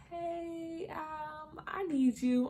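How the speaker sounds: high, drawn-out imitated plea; sheepish request. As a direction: hesitantly call for help, embarrassed. Sheepish call to the nurse.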